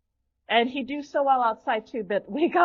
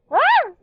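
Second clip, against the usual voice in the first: startled scream; 14 semitones higher, 7 dB louder than usual. Real, not acted. Drone nearly hits her.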